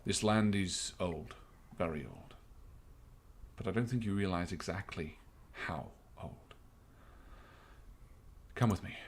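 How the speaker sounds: lightly voiced captain, calm and grave; understated officer with grave knowledge; measured captain. Game master voices the captain answering.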